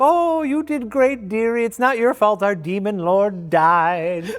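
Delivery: mock-sweet elderly comfort; sweet old couple. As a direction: coo it like a kindly old lady. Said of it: "this happy old couple, like".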